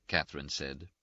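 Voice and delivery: ordinary delivery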